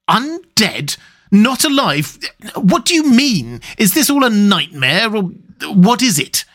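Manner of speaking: high-pitched, distraught questions; horrified disbelief. A narrator's reading. Lover hearing the word undead, horrified. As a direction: reel from it, voice pitched right up.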